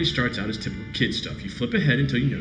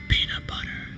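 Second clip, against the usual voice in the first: whispered password; 3 dB quieter than usual. Acted. Speaking the magic word.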